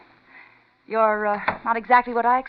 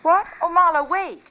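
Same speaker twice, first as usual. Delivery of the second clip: startled plea; 10 semitones higher, 4 dB louder than usual. Acted. Client pleads as he leaves.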